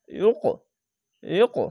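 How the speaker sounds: ইউকো opened with a tight, heavy ain; thick throat sound. Shown as a demonstration. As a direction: squeeze the throat on the start of ইউকো. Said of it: "একটু মোটা করে গলা চিপে পড়বেন". (ইউকো-র শুরুটা গলা চিপে মোটা করে)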